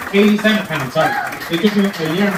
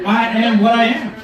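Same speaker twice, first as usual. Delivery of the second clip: higher-pitched, emphatic repeat of a quote; quoting God triumphantly; 5 semitones higher than usual. Retold or imitated. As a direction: throw the quote back, pitched up.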